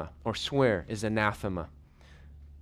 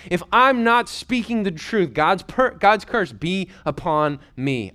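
cursing oath; 6 semitones higher, 6 dB louder than usual. Acted. Peter calling a curse on himself.

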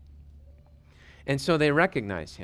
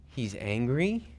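normal talking voice

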